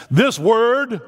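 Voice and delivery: a hint of big booming preacher; Joel Gregory's voice. Pastor imitating his old preacher, a lightly voiced booming orator. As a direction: lift it up grand and slow. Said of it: "the way he says this".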